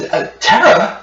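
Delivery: high, startled call of the name; second brother's high-pitched call; other brother. Acted. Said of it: "And then your other brother".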